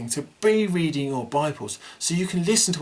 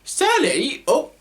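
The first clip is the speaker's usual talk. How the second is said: high puppet voice; 9 semitones higher than usual. Acted. Puppet Sparky calling Sally.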